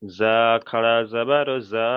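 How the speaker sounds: "জা" open, no l, vowel drawn out; corrected model reading. Shown as a demonstration. Teacher modelling for pupil, slow open "জা". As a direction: spell it slowly, holding each "জা" long. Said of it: "জাল নয়, জা".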